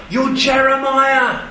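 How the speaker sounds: high, slow mock shout. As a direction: call the answer out, drawing it out. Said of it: "Someone else shouts out".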